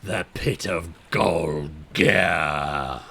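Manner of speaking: deep, slow, booming ancient voice; ancient rasping undead king. Acted. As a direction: speak slowly from the depths. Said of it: "and the face says".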